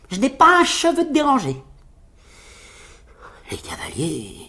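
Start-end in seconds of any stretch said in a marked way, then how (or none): 0.1-1.6 high, airy brag; boastful little tailor. Acted. Tailor assures the riders he is unhurt, boasting. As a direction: toss it off proudly, pitched high.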